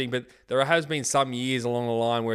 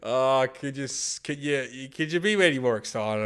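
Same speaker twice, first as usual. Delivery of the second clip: sarcastic. Real, not acted. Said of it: "very excited in my always monotone voice".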